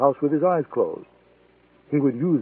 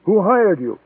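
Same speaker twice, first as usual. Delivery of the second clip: higher-pitched, controlled interrogation; pointed question pitched up; firm demand; 6 semitones higher, 3 dB louder, slower than usual. A narrator's reading. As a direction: demand an answer, not yet shouting. First demand over intercom in standoff.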